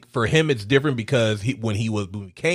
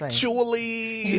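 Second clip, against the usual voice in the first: drawn-out mock correction; 10 semitones higher, 6 dB quieter, much slower than usual. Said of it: "And he was like".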